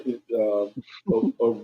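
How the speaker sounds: ordinary talk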